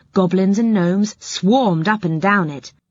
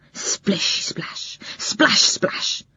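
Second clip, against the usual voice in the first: whispered splash; 3 dB quieter, much slower than usual. A narrator's reading. Narrator voices the splashing.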